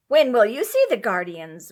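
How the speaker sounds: loud, impatient question; irrepressible impatience. A narrator's reading. Impatient wife pushes husband to see guardians. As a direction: press him, can't wait. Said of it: "asked with irrepressible impatience".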